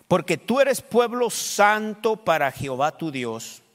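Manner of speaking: loud, slow, preached reading of scripture; booming pulpit reading. Acted. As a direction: proclaim the verse to the congregation. Pastor reads scripture aloud.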